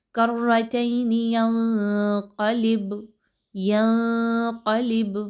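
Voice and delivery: ب of ینقلب bounced with a small echo, slow; qalqalah. Shown as a demonstration. Teacher demonstrates the qalqalah bounce for students. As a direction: jiggle the final ب of ینقلب. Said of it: "یہ جو انداز ہے اسی کو قلقلہ کہا جاتا ہے".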